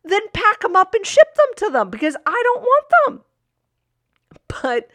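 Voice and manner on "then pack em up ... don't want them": higher, louder mock childish whine; bratty kid retort. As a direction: sass it back like a fed-up kid. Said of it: "And I wanted to tell her".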